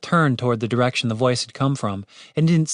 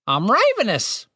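high-pitched British bird voice; little bird, pitched way up; talking bird; 10 semitones higher than usual. A narrator's reading. Bird introduces itself.

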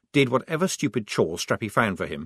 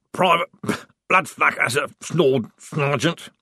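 accusing; 5 semitones higher, 4 dB louder than usual. Acted. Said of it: "he said accusingly".

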